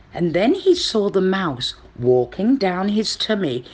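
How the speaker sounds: ordinary delivery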